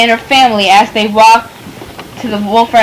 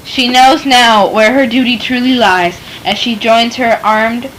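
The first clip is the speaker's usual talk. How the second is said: ordinary delivery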